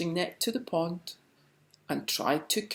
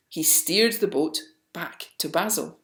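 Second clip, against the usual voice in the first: plain reading voice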